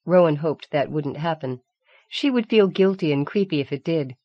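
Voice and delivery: usual speaking voice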